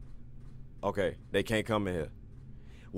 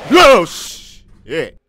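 startled shout; 9 semitones higher, 18 dB louder than usual. Real, not acted. Jump scare.

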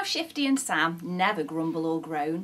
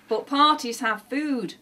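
mild, louder grumble of protest; grumbling; 4 semitones higher, 5 dB louder than usual. A narrator's reading. Sam objects to the party plan, grumbling. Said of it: "grumbled Sam".

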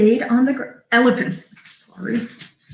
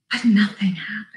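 whispered anticlimax; hushed suspense; faster than usual. A narrator's reading. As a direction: whisper it like a letdown. Suspense after the big push.

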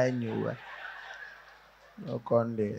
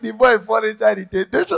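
laughing through it; 8 semitones higher, 6 dB louder than usual. Real, not acted. Laughing at own punchline.

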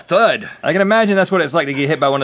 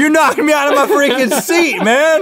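laughing; 11 semitones higher than usual. Real, not acted. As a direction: laugh while shouting it. Knocked out of seat.

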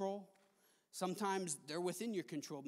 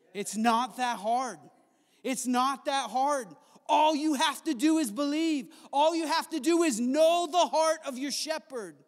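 preaching at full force; 5 semitones higher, 9 dB louder than usual. Acted. Pastor rouses the congregation to simply believe, swelling to a loud peak. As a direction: drive it home, rising.

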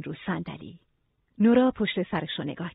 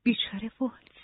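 sad murmur; 3 semitones higher, 5 dB quieter than usual. A narrator's reading. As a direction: murmur it sorrowfully and gently. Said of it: "نورا با صورتی غمگین زمزمه کرد". (زمزمهٔ غمگین و آهسته)

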